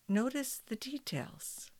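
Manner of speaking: soft whisper. Acted. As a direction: breathe the words out, unvoiced. Whisper inside guided meditation.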